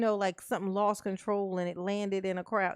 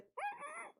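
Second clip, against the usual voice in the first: a small, very high, very soft "mm-mm" whimper; high squeaky whimper; 14 dB quieter than usual. Retold or imitated. Retelling her reaction to watching the video, a high soft whimper. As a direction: squeak it out, almost silent. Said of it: "I made this noise".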